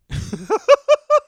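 big high cackling laugh; loud burst of laughter. Real, not acted. Host cracking up at his friend throwing the corn away. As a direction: let the laugh explode out.

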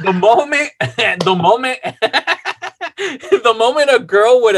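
breaking into laughter mid-sentence; laughing. Real, not acted. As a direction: struggle to get the words out through laughs.

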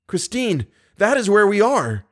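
high, rising exclamation; urgent revelation. A narrator's reading. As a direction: call it out urgently, pitched high. Calling through wall to captive.

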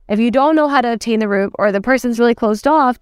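normal talking voice

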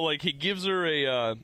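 ordinary delivery

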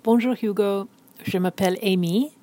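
usual speaking voice

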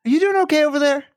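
high mock-concerned sing-song; playful check-in. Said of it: "where I would like to begin my segment".

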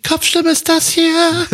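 high, slow falsetto; head voice. Shown as a demonstration. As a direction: sing it up in a thin falsetto. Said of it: "Kopfstimme ist nämlich".

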